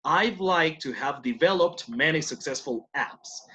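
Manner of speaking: slow, careful example sentence; slow model answer. Shown as a demonstration. Teacher models a sample answer slowly. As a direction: read it out slowly for students. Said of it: "For example".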